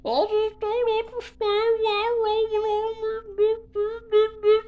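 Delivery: high, slow, wailing fake sob; mock crying. Showing how Square cries online, mock sobbing wail. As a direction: sob it like a hammed-up crybaby. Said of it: "you ever seen how he cries".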